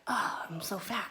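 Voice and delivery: mock whispered put-down to yourself; a hint of muttered self-criticism; whispered self-insult. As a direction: hush it like a private jab. Acting out mean self-talk.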